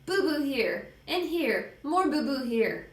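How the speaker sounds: put-on high toddler voice; little kid showing hurts. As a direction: point out each hurt pitifully. Small child shows his wounds.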